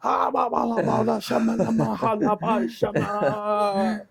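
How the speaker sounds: high-pitched gibberish mock scream; garbled screaming impression. Friend mimicking the incoherent man on the mic, garbled screaming. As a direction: screech unintelligible syllables, dragging out the last. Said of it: "we couldn't understand a word he was saying".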